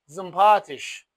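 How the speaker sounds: "زومپاتیش" with rounded first vowel and loud long a; careful demonstration of "زومپاتیش", y rounded toward u; thick German u-sound. Shown as a demonstration.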